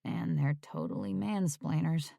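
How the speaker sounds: soft muttered aside; under my breath. Acted. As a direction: say it quietly so she won't hear. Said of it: "I said under my breath".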